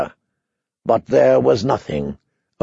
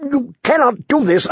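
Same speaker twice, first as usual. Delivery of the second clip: desperate plea; 11 semitones higher than usual. A narrator's reading. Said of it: "the prisoner asked desperately".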